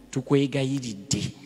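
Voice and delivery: normal talking voice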